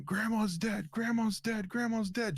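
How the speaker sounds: high, tearful mock wail; retold crying scream. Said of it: "I'm screaming".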